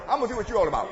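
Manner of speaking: loud, high preacher's cry; preaching at full force. Acted. Preacher shouting to the congregation about the heart. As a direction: drive it home to the pews.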